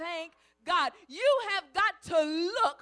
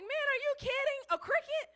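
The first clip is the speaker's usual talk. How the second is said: incredulous; 9 semitones higher than usual. Retold or imitated. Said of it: "He's like".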